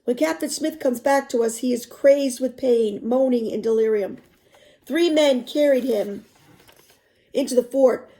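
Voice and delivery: expressive read-aloud narration; story narration. A narrator's reading. Teacher reading a novel to students, expressive narration.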